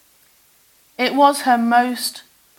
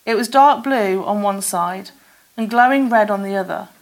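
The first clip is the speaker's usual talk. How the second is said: ordinary delivery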